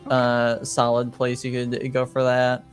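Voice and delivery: ordinary talk, nothing marked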